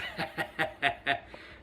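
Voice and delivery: high chuckle after own impression; amused laugh. Real, not acted. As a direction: crack up at your own imitation. Laughs at own chant imitation.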